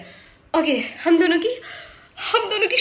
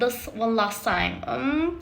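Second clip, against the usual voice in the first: slow, lower, put-on English accent; mock foreign accent; 3 semitones lower, 4 dB quieter, much slower than usual. Mocking the suitor's English accent, slow. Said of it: "विलायती accent बाहर आ गया".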